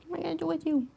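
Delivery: high soft sing-song question. Real, not acted. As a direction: coo it at the cat. Fond scolding of cat, high.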